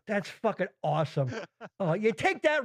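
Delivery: usual speaking voice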